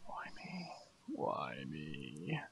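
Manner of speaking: whispered mock lament; hushed, hammed-up woe; mock self-pity. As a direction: whisper it like a put-upon victim. Mock lament while being teased.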